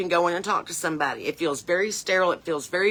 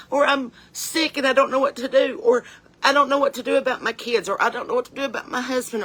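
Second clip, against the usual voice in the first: pleading for help; 5 semitones higher than usual. Pretend. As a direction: voice a frightened caller reaching out for a friend. Voicing a scared person calling for help.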